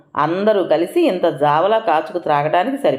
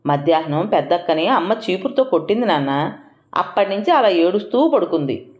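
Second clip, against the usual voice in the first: plain reading voice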